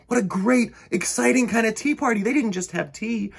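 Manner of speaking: ordinary delivery, nothing marked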